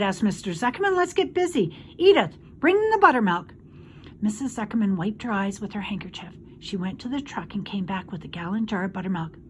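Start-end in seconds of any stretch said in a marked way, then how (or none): 0.9-3.5 high, loud, bustling order; rally everyone with a raised voice; high-pitched cheerful command; brisk rallying. A narrator's reading. Farmer briskly rallies tearful family.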